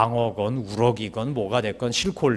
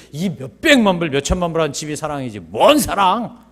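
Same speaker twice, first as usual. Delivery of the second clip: acted angry outburst; 2 semitones higher, 7 dB louder than usual. Rich husband reacting to his wife's letter, angry. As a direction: start irritated, then erupt in loud disbelief. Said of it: "이러면서 막 화를 내다가".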